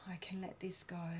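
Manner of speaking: a calming whispered affirmation. Shown as a demonstration. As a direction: whisper I can let this go. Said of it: "a bit of a release".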